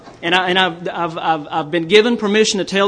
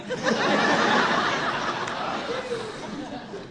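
high-pitched laugh; 7 dB quieter than usual. Real, not acted. Laughing at his own punchline.

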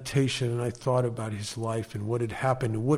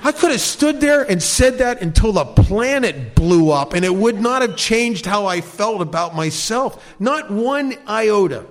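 higher and louder, laughing through it; laughing scorn; 6 semitones higher, 6 dB louder than usual. Real, not acted. Scoffing at the therapist's exercise, laughing higher and louder. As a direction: laugh off the idea, loud and high.

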